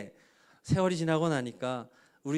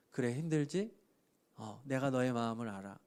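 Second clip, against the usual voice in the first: tender comfort; 5 semitones lower, 6 dB quieter than usual. Retold or imitated. Jesus comforting the believer.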